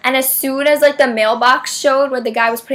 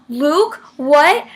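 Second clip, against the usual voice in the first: questioning, higher-pitched double take; puzzled reaction; 4 semitones higher, 4 dB louder than usual. Retold or imitated. Reacting to girls screaming a name, puzzled surprise. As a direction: ask it like you can't believe your ears. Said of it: "So I was like".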